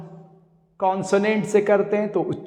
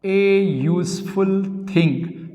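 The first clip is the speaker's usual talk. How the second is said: slow demonstration; slower than usual. Shown as a demonstration. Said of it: "तो हमको लिखना पड़ता है ए यूजफुल थिंग".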